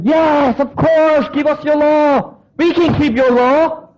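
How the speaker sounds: eager people. Retold or imitated. Preacher voicing arrogant people accepting the law, high and eager. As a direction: gush like overconfident people.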